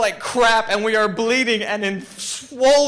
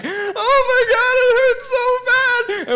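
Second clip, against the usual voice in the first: pained yelling; 5 dB louder than usual. Retold or imitated. Storyteller reliving burning in thorny water, wailing yell. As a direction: cry it out loud and high, in agony. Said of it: "I'm yelling".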